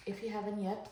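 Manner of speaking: usual speaking voice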